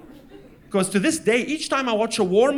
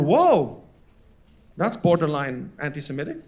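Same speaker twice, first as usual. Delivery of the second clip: low, measured disapproval; mock-reasonable low objection; cautioning dad; 6 semitones lower than usual.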